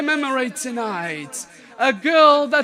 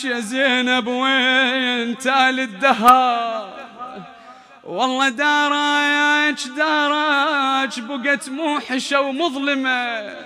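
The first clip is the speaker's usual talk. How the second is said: elegiac poetry recital; 2 semitones lower than usual. Acted. Reciter mourning Zainab's empty house, grieving chant. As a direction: linger on each call of the house.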